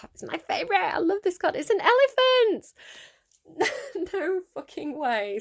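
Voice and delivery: rising to a high, loud delighted squeal; excited squeal. Real, not acted. Reader finding her favourite elephant card, squealing with delight.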